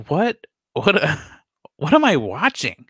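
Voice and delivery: high baffled what, what; mock confusion, laughing a bit; lightly voiced disbelief; bewildered. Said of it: "I'm just like".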